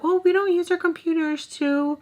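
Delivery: high, prissy staff voice; a hammed-up prim clerk; prim library lady.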